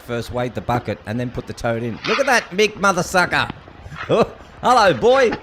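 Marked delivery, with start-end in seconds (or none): 2.1-5.3 loud, high burst of amazement; excited exclamation. Real, not acted. Host seeing a giant toad on video, loud excitement. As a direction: hail the huge creature, loud and high.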